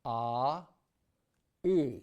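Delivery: vowel demo ah then E. Shown as a demonstration. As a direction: keep the mouth open and move the tongue. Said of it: "towards more of an E position".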